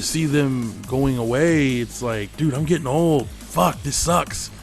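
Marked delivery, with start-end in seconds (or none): none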